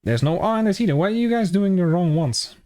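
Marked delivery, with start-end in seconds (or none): none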